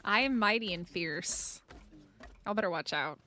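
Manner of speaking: a half-hearted mighty-beast boast, low-key; mock fierce. Streamer boasting to chat like Thundercats, low-key mock fierceness. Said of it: "This is cringier than Battle Cat".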